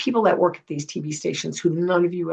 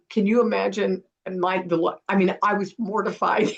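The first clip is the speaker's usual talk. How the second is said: normal talking voice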